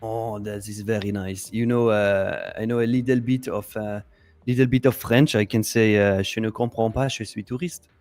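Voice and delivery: a light hammed-up French accent; mock French accent. Trader chats about French phrases in a mock French accent. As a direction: play the lost French tourist lightly. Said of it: "enjoying the French accent".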